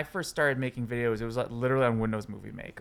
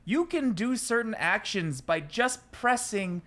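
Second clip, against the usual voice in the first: mock amazement; 7 semitones higher than usual. Editor telling friends about newly discovered hotkeys, mock amazed. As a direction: share it like astonishing news, pitched way up.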